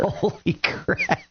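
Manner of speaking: slow, stunned holy crap; drawn-out disbelief at the cold; shocked. Real, not acted. Reacting to minus twenty-four.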